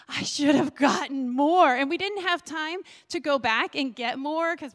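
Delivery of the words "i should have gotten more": louder, firm restated regret; louder exclamation. Retold or imitated. To the congregation, repeating her thought, louder and firm. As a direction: say it out loud this time, with feeling. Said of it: "and I thought".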